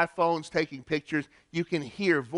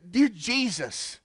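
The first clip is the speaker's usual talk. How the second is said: slow, higher imitated plea, a touch of desperation; desperate pleading; 6 semitones higher, slower than usual. Tourists on the boat crying out as the cloud comes, slow pleading. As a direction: voice a frightened tourist begging, mildly. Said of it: "pleading and the desperation in their voice".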